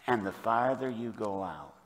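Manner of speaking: trailing down into a soft, low hush; hushed smallness. Real, not acted. Preacher recalling the open ocean, voice shrinking to a hush. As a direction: let the voice shrink away after a long pause. Feeling small far out at sea.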